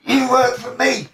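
high-pitched, insistent protest; indignant retelling. Retold or imitated. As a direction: act out laying down the law. Acted quarrel, insisting on his point.

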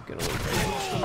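whispered plea; tense whisper. Real, not acted. Gamer muttering to a nearby enemy, tense whisper. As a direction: hiss it under breath.

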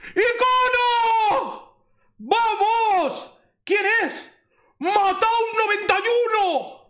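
very high, drawn-out celebratory shouts; ecstatic screaming. Real, not acted. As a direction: scream it like a jackpot win. Screams at pulling an icon card. (gritando eufórico)

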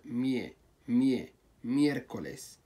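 مي- repeated slowly, then ميركوليس in full; careful pronunciation. Shown as a demonstration. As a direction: build up ميركوليس from its first syllable مي. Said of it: "لاحظوا كيف نطقها". (نطق ميركوليس ببطء بدءًا بمي)

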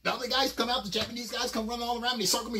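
ordinary talk

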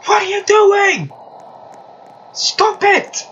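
very high, squealed protest; high-pitched outburst. Real, not acted. Gamer protesting at another player, high-pitched outburst.

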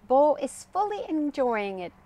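usual speaking voice

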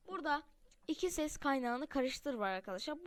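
ordinary talk, nothing marked

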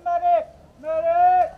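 loud high call for a medic; man down shout. Real, not acted. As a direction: shout it over the field. Hit, calling for a medic.

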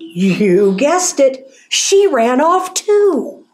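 ordinary delivery

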